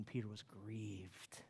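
slow, soft, heavy reading; grieved narration. A narrator's reading. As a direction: let the grief sink in slowly. Dramatic sorrowful narration.

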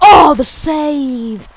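sharp gasp, then loud drawn-out exclamation; loud, high gamer amazement; amazed shout. Real, not acted. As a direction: gasp and holler it slowly. Exclaiming at a save.

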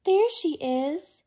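high, loud excited call; happy loud bird cry; excited shout. A narrator's reading. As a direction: shout it with relief. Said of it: "he said".

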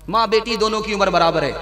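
ordinary talk, nothing marked

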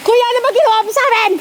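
high, fast, half-laughing protest; mock complaint. Hiker teasing the tour guide about the climb, a high, fast mock complaint. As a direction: tease the guide with a playful mock scold.

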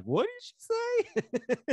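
very high, slow, drawn-out scandalized gasp; shocked bystander. Retold or imitated. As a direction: play a stunned shopper overhearing. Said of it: "the look of all the people in the store".

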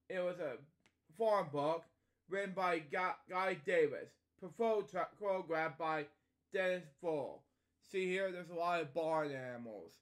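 ordinary delivery

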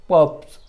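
पप्स with "uh" as its vowel; short U modelled. Shown as a demonstration. Teacher showing short U in a word, पप्स said deliberately. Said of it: "अ असा केला जातो".

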